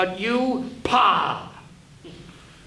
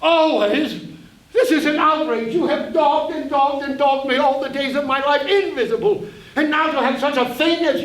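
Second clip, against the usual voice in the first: loud, higher, laughing outrage; indignant outburst; 6 semitones higher, 4 dB louder than usual. Acted. Narrator outraged at his conscience, loud and higher. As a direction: explode in disbelief at Always.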